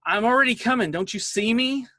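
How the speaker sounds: son's indignant yell, pitched high; retold impatient holler from another room; imitated yelling. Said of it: "I hear him yelling at me".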